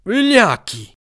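high-pitched jeering cry; shouted insult. A narrator's reading. Carruba calls after the fleeing enemies, high jeering shout. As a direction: hurl it after them. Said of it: "gridò Carruba dietro ai fuggiaschi".

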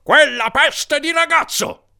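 high, slow roar; furious outburst. A narrator's reading. Larry blames the boy again, roaring. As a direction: roar each word in exasperation.

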